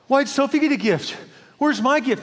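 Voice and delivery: put-on high, jealous childish protest; mock freak-out. Jealous relative at family gift opening, mock freak-out. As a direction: act out a panicked gift tantrum. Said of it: "no one freaks out and goes".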